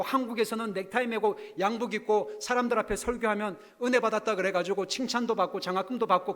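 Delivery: ordinary talk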